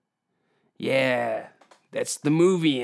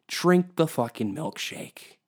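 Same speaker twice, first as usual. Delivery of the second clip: ordinary talk, nothing marked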